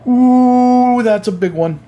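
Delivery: high, loud impressed ooh; surprised ooh. Real, not acted. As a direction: react like that's big news. Reacts to sales news.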